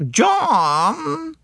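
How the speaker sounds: very high drawn-out call; imperious wife calling. A narrator's reading. Wife summoning her husband on deck, high imperious call. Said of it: "swept down the deck and said".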